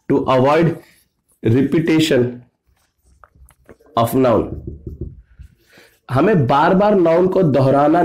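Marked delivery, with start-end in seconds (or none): 0.1-4.5 chunked dictation. Shown as a demonstration. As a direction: separate phrases with long pauses. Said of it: "तो ये क्या करता है". (रुक-रुक कर लिखवाना)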